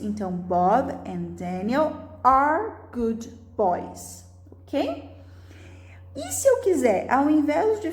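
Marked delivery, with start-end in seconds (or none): 0.3-4.2 slow, clear "are" in the middle; stress on are. Shown as a demonstration. Teacher repeats the plural example slowly, stressing "are". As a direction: stretch out "are" for the class. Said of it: "eu estou falando dos dois".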